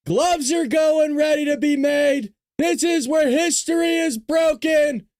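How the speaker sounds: high, slow, stretched-out fight-night intro; ringside announcer. Acted. Host opening the show where history is broken, ringside announcer. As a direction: draw out each phrase for the crowd.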